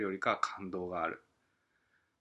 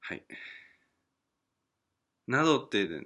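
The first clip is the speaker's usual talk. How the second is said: normal talking voice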